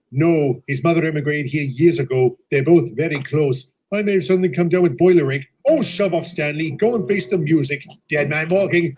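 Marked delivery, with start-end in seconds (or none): none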